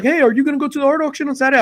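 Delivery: bright high quick question; a friend asking in passing; quick friendly question. Retold or imitated. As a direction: toss it off fast and eager. Omar asks him, quoted.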